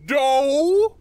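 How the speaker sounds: high, loud, drawn-out "no"; frustrated shout. Real, not acted. Player losing the game, frustrated shout. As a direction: cry out in dismay, long and high.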